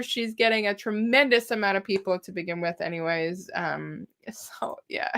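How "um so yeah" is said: whisper. Real, not acted. Whispered aside before laughing.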